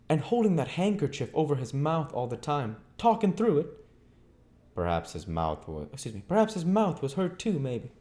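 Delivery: high, chattering landlady voice; lightly rustic landlady gabbling on; Mrs Hall's voice. A narrator's reading. Mrs Hall voiced in story.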